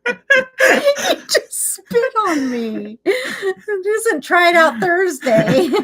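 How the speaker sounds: words squeezed out high and slow through real laughter; can barely speak for giggling; laughing hard. Real, not acted. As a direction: mom cracking up at her son on the livestream. Laughing fit after son's joke.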